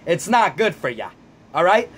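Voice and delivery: ordinary delivery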